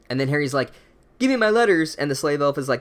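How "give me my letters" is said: high, loud demand. Acted. Harry to Dobby, demanding his letters. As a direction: snap for the letters.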